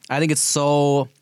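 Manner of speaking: ordinary talk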